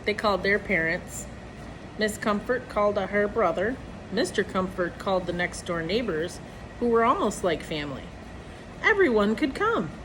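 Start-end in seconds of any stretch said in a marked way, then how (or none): none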